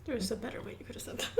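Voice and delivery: quick, soft words half laughed; laughing tease. Real, not acted. Cohost teasing the host's clumsy wording, softly laughing.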